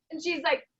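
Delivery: ordinary delivery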